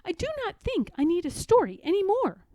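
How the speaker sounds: high and fast, perked-up frog; Frog's voice. Acted. Frog telling Toad he's better, high and quick. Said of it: "said Frog".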